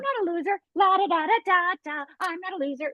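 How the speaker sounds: high, breezy show-off voice; self-mocking high bravado; mock bragging. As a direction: prove you're no loser, a little too eagerly. Mocking her own fearful bragging.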